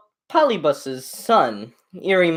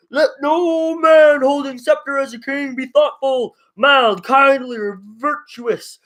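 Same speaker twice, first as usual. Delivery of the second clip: put-on higher, louder, ringing old orator; Mentor's rebuke; 10 semitones higher, 5 dB louder than usual. Mentor rebukes the Athenian assembly, loud and indignant. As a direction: call out to the assembly in outrage.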